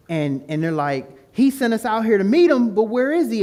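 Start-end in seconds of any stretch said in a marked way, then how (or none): 1.3-3.4 high, loud, agitated complaint; mock freaking out. Disciples wonder where Jesus is, mock panicked. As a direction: imitate disciples fretting in a storm. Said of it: "freaking out because the storm is getting".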